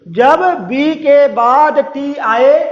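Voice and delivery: very slow, spaced-out rule reading; teacher repeating the rule very slowly. Shown as a demonstration. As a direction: say it slowly so they catch it. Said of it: "फिर से सुनिए".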